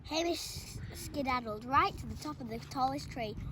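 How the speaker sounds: plain reading voice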